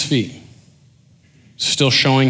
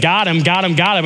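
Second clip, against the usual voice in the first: higher, eager mock gloat; 5 semitones higher than usual. Pharisee thinking he has trapped Jesus, gloating. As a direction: repeat it like a trap is springing.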